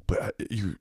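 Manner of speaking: whispered imitation of the guy; hushed question. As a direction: lean in and whisper it.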